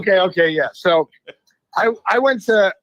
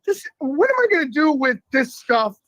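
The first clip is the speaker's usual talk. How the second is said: higher-pitched retold protest; exasperated pleading; 5 semitones higher than usual. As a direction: plead your case to the teacher. Retold pleading argument with the teacher.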